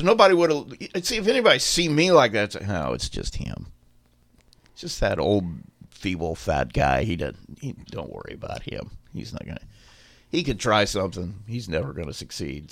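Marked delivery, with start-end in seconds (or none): 2.7-12.7 low, soft brush-off of a harmless guy; mildly dismissive. Retold or imitated. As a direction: wave him off, trailing lower and quieter. Onlookers dismissing him in imagined quote.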